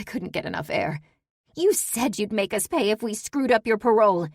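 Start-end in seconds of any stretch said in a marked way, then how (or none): 1.6-4.3 higher, raised accusing voice; angry accusation. Acted. Daughter recalls the parole threat, angry and raised. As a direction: list her threat back angrily.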